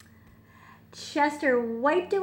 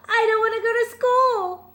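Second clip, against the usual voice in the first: put-on high, loud little-kid whine; crying young raccoon; 12 semitones higher, 9 dB louder than usual. Chester begging his mother to stay home, whining high. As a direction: plead like a tearful child. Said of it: "stood at the edge of the forest and cried".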